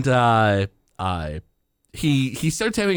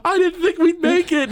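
mock crying; 3 dB louder than usual. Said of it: "Lisa's, like, crying".

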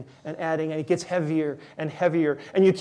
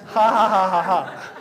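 hearty laugh; 3 semitones higher, 6 dB louder than usual. Real, not acted. Laughs at himself.

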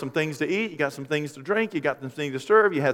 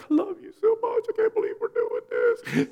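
gushing bride. Retold or imitated. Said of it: "they're like".

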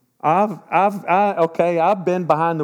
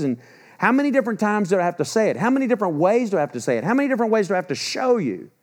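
higher-pitched, insistent string of rhetorical questions; exasperated divine scolding; 7 semitones higher than usual. Acted. God reproaching people who ignored his warnings, exasperated. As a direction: press each question, speeding up toward the end.

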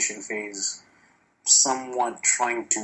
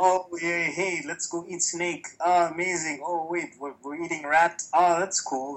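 high, wide-eyed delighted tourist; breezy, higher voice, impressed by everything; mock enthusiastic; 7 semitones higher than usual. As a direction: gush over everything like a naive newcomer. Mock giddy newcomer quote.

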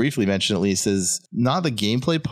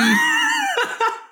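a burst of high squealing laughter; high shrieking laugh. Real, not acted. Laughing at own joke.

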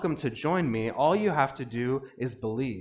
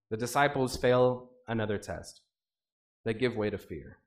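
ordinary talk, nothing marked

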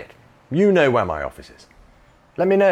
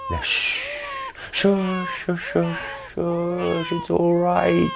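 put-on hushing a baby; 4 semitones higher, much slower than usual.

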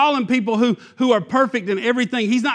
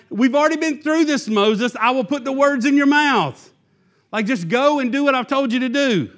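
high-pitched, exasperated insistence; irritated God; 6 semitones higher than usual. Retold or imitated. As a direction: scold like a parent whose patience has run out. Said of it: "I'm getting a little irritated with you".

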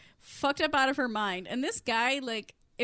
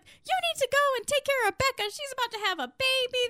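high, insistent mock nagging; urgent nagging; 12 semitones higher than usual. Sonia sends Emmy to help Becca, urgent nagging. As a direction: push it high, running down the demands.

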